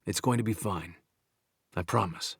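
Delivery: soft, gentle promise to a tearful woman; doctor softly reassuring a crying patient; soft reassurance. A narrator's reading. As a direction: hush it down and comfort her. Brooks comforts the tearful Tony.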